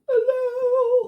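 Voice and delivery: very high sung falsetto hello; showing off a falsetto note. Shown as a demonstration. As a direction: float it up in falsetto. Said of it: "I can do falsetto".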